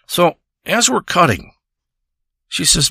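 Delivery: ordinary talk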